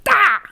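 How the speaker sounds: sharp high shriek, very loud; startled scream. Acted. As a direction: yelp as the needle goes in. Victim gets injected.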